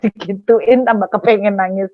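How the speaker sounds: ordinary talk, nothing marked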